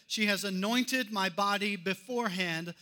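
usual speaking voice

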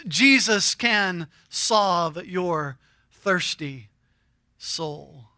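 slow soft sermon murmur; hushed. Acted. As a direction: lean in and let it fall to almost nothing. Preacher drops to intimate hush.

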